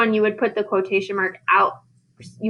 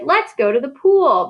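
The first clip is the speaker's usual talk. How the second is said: higher, mildly raised call; mock kids' shout; 5 semitones higher, 4 dB louder than usual. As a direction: call it out like kids shouting, lightly. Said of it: "The kids shouted".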